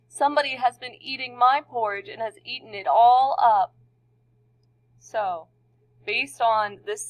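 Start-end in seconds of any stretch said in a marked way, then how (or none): 0.1-3.7 put-on small squeaky baby voice. A narrator's reading. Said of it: "in his small, squeaky baby voice".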